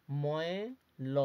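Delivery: slow spelling out. Shown as a demonstration. Teacher to pupils, spelling ময়ল out very slowly. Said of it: "এরপরে".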